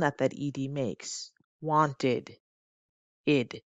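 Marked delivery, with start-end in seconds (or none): 1.6-2.4 Wanted with a clear id ending. Shown as a demonstration. Teacher models the id ending for students, clearly. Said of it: "the first sound that the E D makes in English is the id sound". 3.3-3.6 id said alone as a sound; isolated id sound. Shown as a demonstration. Teacher isolates the ending for students, clearly. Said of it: "the id sound".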